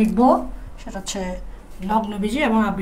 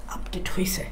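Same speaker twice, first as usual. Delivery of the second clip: soft unvoiced whisper; whispered answer; 6 semitones lower, 12 dB quieter than usual. Teacher answering her own question in a whisper.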